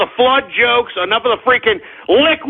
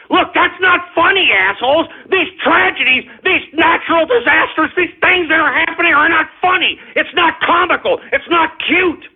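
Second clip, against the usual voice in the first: indignant scolding; 6 semitones higher than usual. Acted. Angry rant at trolls.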